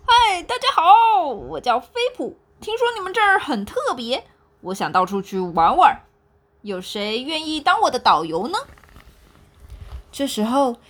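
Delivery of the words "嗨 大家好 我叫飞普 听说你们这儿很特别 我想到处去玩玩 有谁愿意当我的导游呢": high, loud eager greeting; excited monkey. Acted. Monkey greeting the penguins, excited and high. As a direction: burst in full of excitement. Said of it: "猴子很兴奋地说".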